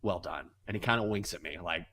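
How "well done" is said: sly praise. Retold or imitated. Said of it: "he goes".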